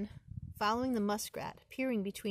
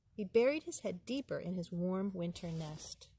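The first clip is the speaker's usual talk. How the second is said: ordinary delivery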